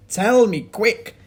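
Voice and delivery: high, loud, hurried pleading; urgent Mister Cranky. A narrator's reading. Mister Cranky urges George to tell him quickly. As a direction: press him to tell you now. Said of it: "cried Mister Cranky".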